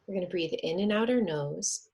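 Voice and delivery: normal talking voice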